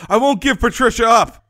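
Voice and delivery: high, loud mock-gallant vow; hammed-up chivalrous declaration; noble vow. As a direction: play the gallant knight protecting her. Mock heroic vow of defendant.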